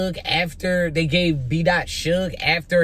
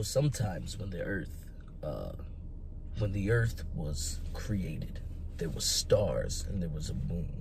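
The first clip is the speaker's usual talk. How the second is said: [name] impression; 11 semitones lower, 12 dB quieter than usual. Vlogger imitating a rapper's deep voice to hype the battle. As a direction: lower it all down into a deep, quiet growl of a narrator. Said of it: "my best [name] impression".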